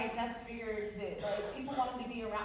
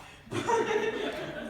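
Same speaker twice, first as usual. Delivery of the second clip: breathy laugh; 12 semitones higher, 6 dB louder than usual. Real, not acted. Laughing at herself.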